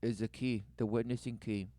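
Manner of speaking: hammed-up solemn preaching; mock sermon. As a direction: preach it like a grave prophet.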